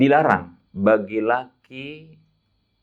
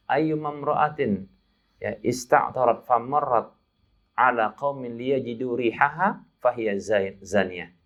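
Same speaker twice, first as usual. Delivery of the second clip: slow, measured Arabic recitation; 1 hadith recitation; slower than usual. Acted. Preacher quoting the Prophet's hadith in Arabic, slow and reverent.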